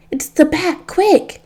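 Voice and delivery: high-pitched, urgent narrated yell; mild panicked cry; yelled warning. A narrator's reading. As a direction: yelp a warning to the others, not at full volume. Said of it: "One yelled".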